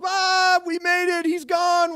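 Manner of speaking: high, loud celebration; jubilant yelling. Retold or imitated. Boys smashing bottles after escape, exultant shouting. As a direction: whoop it out in one rush.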